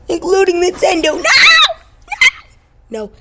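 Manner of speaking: high, loud cry of despair; anguished shout. Acted. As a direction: scream no like it's a tragedy. Mock despair at Nintendo move.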